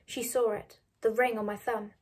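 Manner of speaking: plain reading voice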